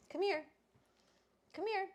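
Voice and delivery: very high coaxing come here; high call to dog. Real, not acted. Owner calling her little dog over, high coax.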